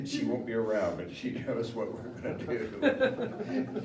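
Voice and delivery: slow words through real laughter; laughing while speaking. Real, not acted. Son at his mom's funeral, teasing her memory while laughing. Laughing during eulogy about mom.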